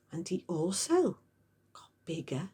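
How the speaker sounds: soft whisper building suspense; hushed whisper. A narrator's reading. Narrator whispering the pony's growth.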